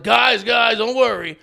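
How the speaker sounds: high, loud host calming a crowd; loud emcee. Retold or imitated. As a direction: boom it out like a stage host.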